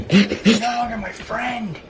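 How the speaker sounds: slow, high and loud betrayal line; milk the betrayal like a soap villain; mock wrestling promo; hammy wrestler melodrama.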